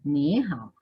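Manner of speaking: "Ni" rising from low, then dipping "hao"; questioning first syllable. Shown as a demonstration. Teacher repeats the greeting for students, questioning first syllable. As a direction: ask on "Ni", settle on "hao". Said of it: "pregunto en la primera sílaba".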